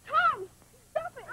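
pleading high over the scuffle; a frightened sister trying to stop the fight; high pleading cry. Acted.

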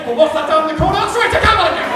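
high, loud reveal; spring it on them; triumphant reveal. Pretend. Punchline reveal.

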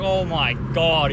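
slow, loud imitation of scorn; mocking disbelief. Old clerk making fun of the customer's question, mockingly incredulous. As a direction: draw it out as if the customer is stupid. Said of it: "starts laughing at me and, like, pretending I'm stupid".

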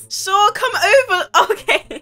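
high, loud giggly reply; helpless laughter in the words; laughing agreement. Real, not acted. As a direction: say yes while cracking up. Agrees to flirty call, laughing.

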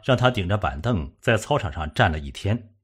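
ordinary delivery

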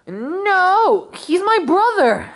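high, squeaky protest; indignant denial. Acted. Indignant denial to teasing question.

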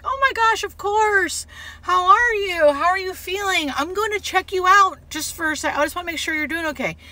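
high, sweet, reassuring bedside chatter; gushing nurse. Retold or imitated. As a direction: humor the happy patient as his nurse. Nurse playing along warmly with patient.

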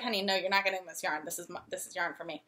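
low, soft, quick brush-off; quietly and quickly claim it, imitating yourself; retold gentle refusal. Retold refusal to give up yarn.

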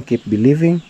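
ordinary talk, nothing marked

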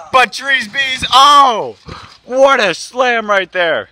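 high, shouted slam call; excited play-by-play. Acted. Commentator reacts to a slam, loud high shout. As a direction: yell it over the crowd.

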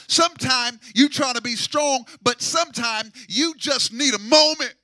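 preacher raising the pitch and volume to the crowd; fervent preaching. Acted. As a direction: build it up and lift the church. Preacher builds to climax.

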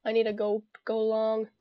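ordinary delivery

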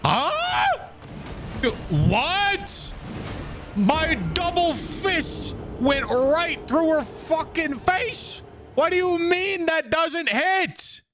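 high, slow incredulous complaining; outraged disbelief. Real, not acted. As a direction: whine in disbelief at the game. Outraged attack didn't hit.

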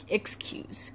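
unvoiced trailing whisper. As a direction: let the word fade to a whisper. Trailing excuse.

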